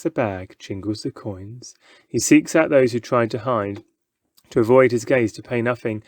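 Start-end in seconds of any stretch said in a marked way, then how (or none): none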